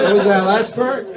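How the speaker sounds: mock double take.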